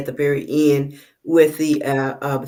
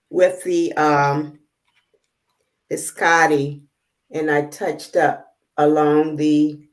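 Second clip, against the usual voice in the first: normal talking voice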